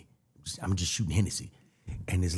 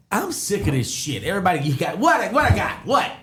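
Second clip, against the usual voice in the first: exasperated; 10 semitones higher than usual. Retold or imitated. Exasperated, retold.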